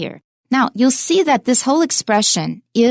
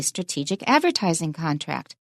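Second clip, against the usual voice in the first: softer recap stressing "strategic" and "advertising"; quietly accent "advertising" and let "contract" fall away; low-key model phrase, "contract" unstressed; stress demo; 3 semitones lower, 7 dB quieter than usual. Shown as a demonstration. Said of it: "然后advertising也有重音".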